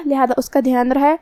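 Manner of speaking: ordinary talk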